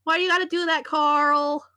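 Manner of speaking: whiny mock complaint. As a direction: wail at Carl in exasperation. Whining at fellow demon Carl.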